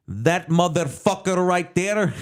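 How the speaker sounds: high, slow imitated Indian accent. Real-place manager pointing out his rival, mock Indian accent. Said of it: "I'm only using the accent for context".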